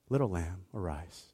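put-on Jesus tenderly waking the dead girl; gentle. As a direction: breathe it softly to her. Contrast with storm command.

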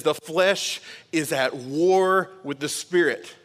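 "The flesh is at war with the spirit" read slowly, leaning on "war"; reading scripture to the church slowly, stressing "war"; slow scripture quote. Acted. Preacher quoting Galatians, slow and weighty. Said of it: "it says".

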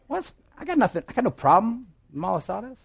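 hammed-up sputtering denial, high; mock wrath. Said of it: "And I'd say, in my wrath".

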